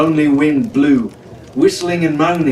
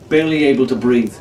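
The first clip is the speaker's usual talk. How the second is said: plain reading voice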